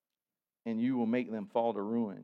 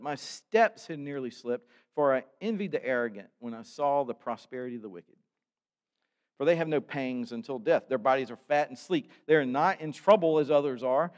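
loud, declaiming psalm reading from the pulpit; preached scripture reading; 2 semitones higher, 5 dB louder than usual. Acted. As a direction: proclaim the psalm to the congregation. Reads psalm aloud, preaching.

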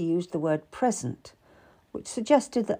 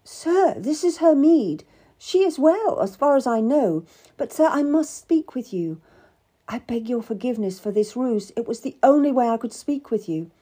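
put-on higher, urgent young petitioner; earnest pleading; 5 semitones higher, 5 dB louder than usual. A narrator's reading. Cat begs a great man for an audience, pleading. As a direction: plead respectfully, pitched up.

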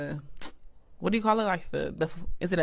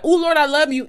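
gushing, higher-pitched declaration of love; mock devotion; 9 semitones higher than usual. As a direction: lay on the pious affection. Fake devotion acted out before eye-roll.

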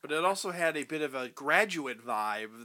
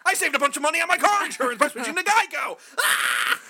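crying shout; 11 semitones higher, 5 dB louder, faster than usual. Pretend.

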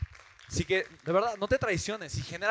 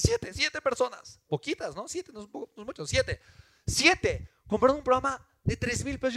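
high, loud, thrilled cries of disbelief; overjoyed, almost yelling; excited; 10 semitones higher, 7 dB louder than usual. Real, not acted. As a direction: shout the number in amazement. Excited recounting of first sales.

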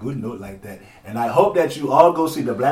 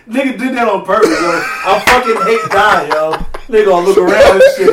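laughing; 8 semitones higher, 10 dB louder than usual. Real, not acted. Crying laughing at the joke.

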